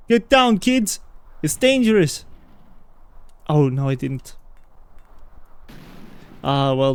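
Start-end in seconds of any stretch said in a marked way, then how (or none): 0.1-2.2 mock parental shout. Mock scolding enemies as kids.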